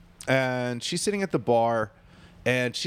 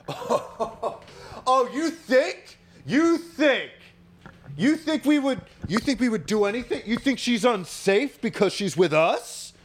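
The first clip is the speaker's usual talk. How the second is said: high-pitched mock indignant tirade; mock outraged, pitched way up, hammering the challenge; imitating friend going off; 9 semitones higher, slower than usual. Pool-table friend challenging the bartenders, mock going off. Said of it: "he's going off".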